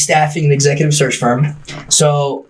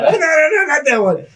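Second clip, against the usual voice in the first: flustered, laughing no-no-no; laughing denial; 13 semitones higher than usual. Real, not acted. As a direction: laugh it off while correcting them. Flustered denial, laughing, wedding mix-up.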